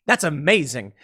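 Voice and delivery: ordinary talk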